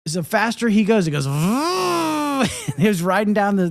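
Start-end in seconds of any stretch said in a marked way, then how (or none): 1.3-2.7 high revving motorbike noise; drawn-out mimicked engine whine; imitated motorbike. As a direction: rev up like a little motorbike. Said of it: "sound like it's a motorbike".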